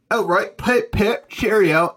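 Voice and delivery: high, hammed-up British caricature; chirpy cartoon Brit, stretched out and badly faked; bad British accent. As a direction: put on a deliberately terrible, high-pitched British accent. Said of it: "this is a shit-ass British accent".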